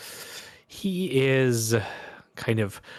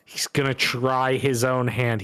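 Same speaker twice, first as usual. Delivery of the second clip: normal talking voice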